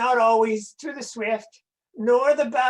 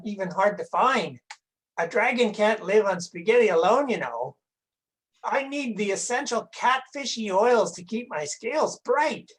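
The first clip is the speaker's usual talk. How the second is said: ordinary talk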